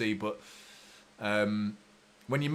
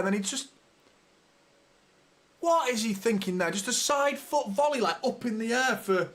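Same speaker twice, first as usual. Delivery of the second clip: furious rant; 4 semitones higher than usual. Real, not acted. Livestreaming fan raging at his defender's mistake. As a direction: vent at the defender who gifted the goal. Fan rants after conceding goal.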